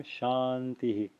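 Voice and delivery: softly said Shantihi, long first syllable, light hi; quiet, slow model of "Shantihi"; short soft echo. Shown as a demonstration. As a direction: let the final hi of Shantihi be a brief breathy echo. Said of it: "it's short and it's soft".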